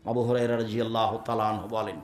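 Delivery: usual speaking voice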